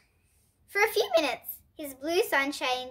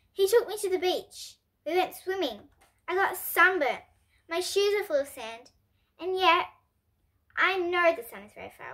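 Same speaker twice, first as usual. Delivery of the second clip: raised, wondering voice of an astonished dad; 5 semitones higher than usual. A narrator's reading. Dad tells mum about the beach, astonished. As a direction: marvel at each thing, a little higher. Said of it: "said the dad in a very astonished voice".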